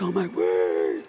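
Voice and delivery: mock winded gasp, low and slow; out of breath. Adults pretending to be winded after running, mock gasp. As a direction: act exhausted after the run. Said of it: "All of us would be like".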